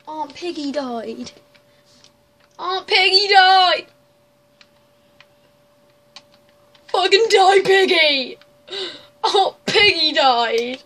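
loud, slow hammed-up grief; mock wailing. Gamer wailing over a dead game pig, mock crying. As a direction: ham up a fake crying fit.

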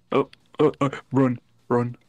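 loud panicked run, run; being chased. Real, not acted. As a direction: yell it as mobs close in. Chased by mobs in game.

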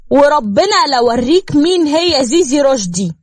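high, loud furious screaming; jilted woman screaming threats at Salim; screaming loudly. A narrator's reading. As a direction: scream betrayal at him. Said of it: "زيزي بصراخ وصوت عالي".

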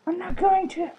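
squeaky frustrated ranting; high-pitched rant. Real, not acted. Ranting at the game train.

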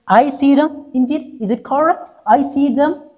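put-on high childish boy asking if he got it right; small boy's voice. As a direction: pipe up like an unsure little boy. [name] repeats a line for mother.

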